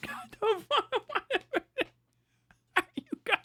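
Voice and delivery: ordinary talk, nothing marked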